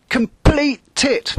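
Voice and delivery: high, loud, slow bellow; bellowed insult. Acted. Skipper bellowing an insult at his crew. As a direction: roar it, stretching each word. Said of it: "he changed his mind again and bellowed".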